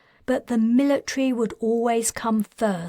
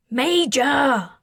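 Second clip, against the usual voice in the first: raised, strained "Major!" from a distance; strained call; 4 semitones higher, 4 dB louder than usual. A narrator's reading. Colburn calling the Major from outside, strained shout. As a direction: call out sharp and impatient, voice straining. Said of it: "Colburn's strained tones".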